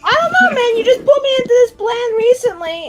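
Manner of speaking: high, loud, flustered outburst; panicked protest. Acted. Nervous mage to partner who roped them into the plan, panicked. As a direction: blurt it out way up high, freaking out.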